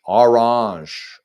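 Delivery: "Orange" loud and drawn out as "ar-ahzh"; odd pronunciation. Shown as a demonstration. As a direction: stretch "Orange" into a mock-fancy "ar-ahzh". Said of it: "You say that weird".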